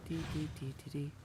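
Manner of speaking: normal talking voice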